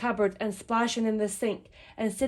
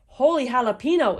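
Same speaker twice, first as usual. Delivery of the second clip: louder, punchy storybook exclamation; 3 semitones higher, 7 dB louder than usual. A narrator's reading. Kitten finds a bird in the toy pile, loud exclamation. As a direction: burst out with lively amazement. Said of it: "exclaimed Skippy John Jones".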